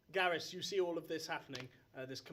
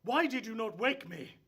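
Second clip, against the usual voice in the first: loud, higher annoyed demand; indignant master; 7 semitones higher, 8 dB louder than usual. Acted. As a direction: scold the guards for not waking him. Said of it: "He goes".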